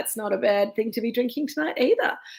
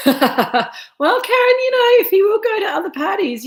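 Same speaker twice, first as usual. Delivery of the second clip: mock whiny voice; 11 semitones higher, 8 dB louder than usual. Mock voice defending absent husband.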